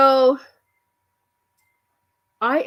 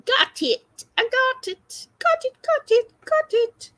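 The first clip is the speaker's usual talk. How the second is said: singsong got it; 12 semitones higher than usual. Pretend. Playful repeated got it, high.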